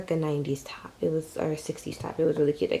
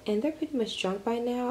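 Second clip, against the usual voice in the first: normal talking voice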